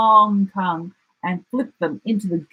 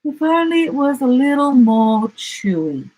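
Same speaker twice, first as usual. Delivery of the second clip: sighing emu; 6 semitones higher, 3 dB louder than usual. A narrator's reading. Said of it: "she sighed".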